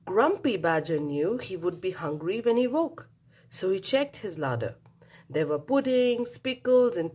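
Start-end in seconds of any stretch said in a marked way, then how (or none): none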